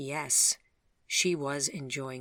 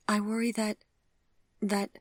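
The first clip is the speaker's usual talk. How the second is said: put-on higher, halting young woman's voice; Vivian, hesitant; 7 semitones higher, 3 dB louder than usual. Vivian confessing her worry to Gregory, higher and halting. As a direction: catch on the words as she starts. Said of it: "she finally said".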